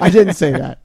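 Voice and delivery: denying it through a laugh; embarrassed laughing denial. Real, not acted. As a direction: laugh while you take it back. Laughing denial after sterling remark.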